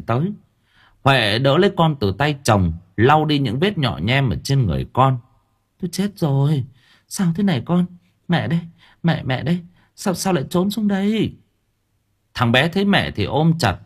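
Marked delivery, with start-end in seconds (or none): 5.8-11.3 higher, fretful mother's voice; a frightened mum fussing over her child; anxious mother. Acted. Mother comforts her hiding son, higher and fretful.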